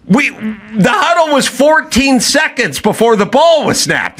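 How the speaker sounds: high, slowed, incredulous; mock disbelief at the teammate; exasperated quarterback. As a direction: quarterback baffled by a blown route. Exasperated QB recounting.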